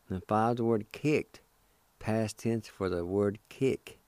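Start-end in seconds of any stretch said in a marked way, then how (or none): none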